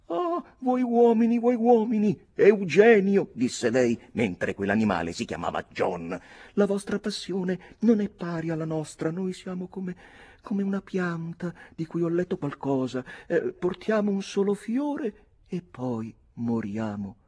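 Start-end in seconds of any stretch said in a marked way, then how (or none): 0.1-3.3 high, loud theatrical lament; voiced girl, high and declamatory; melodramatic young lady. A narrator's reading. Nora melodramatically reproaches her admirer. 6.5-17.2 high-voiced girl pitying herself; melodramatic young lady. A narrator's reading. Nora, the heroine, in a held character voice.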